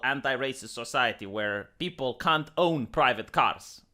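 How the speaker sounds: usual speaking voice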